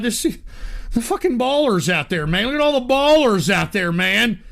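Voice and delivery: ordinary talk, nothing marked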